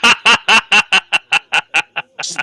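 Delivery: high, loud laughing exclamation. Real, not acted. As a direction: blurt it out through laughter. Cracking up at the name.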